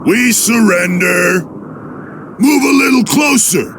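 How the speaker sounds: shouted, luring the enemy closer; a trap disguised as giving up; mock surrender shout. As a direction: call out a fake surrender.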